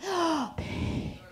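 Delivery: soft, slow breathy come-on; whispering lover. Acted. Man of her dreams whispers to her, hushed and slow. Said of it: "the kind of man that whispers".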